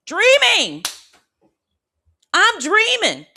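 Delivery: shouting with dream joy; ecstatic shout. Retold or imitated. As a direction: belt it out in wild relief. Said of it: "This is how I was in the dream".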